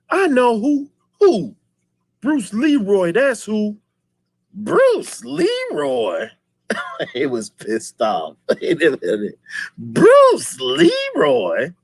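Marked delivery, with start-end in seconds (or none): 0.1-3.8 high, put-on sly voice; mock sidekick. Retelling the movie henchman, high mock voice. 4.5-6.3 high, drawn-out disbelief; mock outrage. Retelling Shogun's reaction, slow mock disbelief. 9.8-11.7 high, drawn-out disbelief; mock outrage. Retelling Shogun's reaction, slow mock disbelief.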